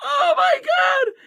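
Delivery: high, slow, drawn-out words through real laughter; laughing in disbelief. Real, not acted. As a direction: struggle to get the insult out while cracking up. Cracking up at the team's blunder.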